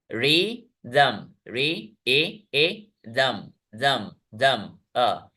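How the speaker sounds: रिदम slowly split into REE and DHUM, each vowel isolated; syllable by syllable. Shown as a demonstration. Teacher isolating vowel sounds for students, रिदम slowly split. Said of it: "लेकिन वहां पे एक sound है".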